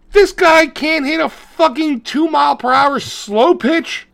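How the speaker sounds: high, incredulous rant; can't believe how dumb this is; exasperated disbelief. Real, not acted. Exasperated rant about the movie.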